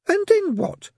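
put-on very high child's demand; squeaky, high questioning; demanding little girl. A narrator's reading. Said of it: "demanded the suspect".

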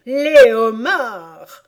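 loud, drawn-out cry; shouting. Acted. Griffon calls out the dance step, shouting. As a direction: build to a big slow shout. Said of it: "cria le griffon".

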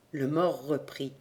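plain reading voice

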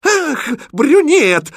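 very high, loud, drawn-out squeal; rapturous exclamation. Acted. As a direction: gasp with delight like a smitten princess. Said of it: "восторженно воскликнула принцесса". (восторженный возглас)

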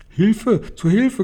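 very high cry for help; storyteller's high mock screech. A narrator's reading. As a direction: shriek it in panic without real volume. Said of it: "kreischte der Mann".

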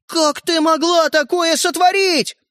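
high, slow, drawn-out outrage; outraged. A narrator's reading. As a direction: accuse her, stretching every word. Brother outraged at his sister. (возмущённо)

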